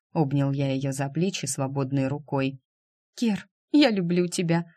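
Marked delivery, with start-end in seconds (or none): 3.2-4.7 сквозь слёзы, лёгкое всхлипывание; девочка, заплакав, шепчет Керу; шмыгая носом. A narrator's reading. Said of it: "шмыгнула". (шмыгая носом)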